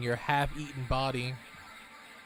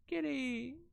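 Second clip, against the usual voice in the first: high, delighted call to the cat; 10 semitones higher, 5 dB quieter than usual. Real, not acted. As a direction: greet the cat with sudden joy.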